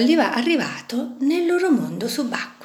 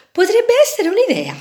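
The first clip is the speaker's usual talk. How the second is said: much higher, quick, pleased with itself; flattered; 12 semitones higher, 6 dB louder, faster than usual. A narrator's reading. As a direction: preen a little at the offer. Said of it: "pensò la vite lusingata".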